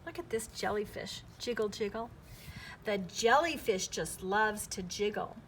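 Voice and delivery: plain reading voice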